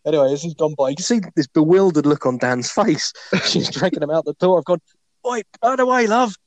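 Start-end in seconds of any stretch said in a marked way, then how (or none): 5.3-6.3 calling out. Retold or imitated. Calling out to the woman dragging Dan out, high cheeky call. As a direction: shout it after her with a wink.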